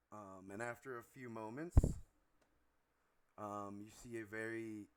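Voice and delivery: usual speaking voice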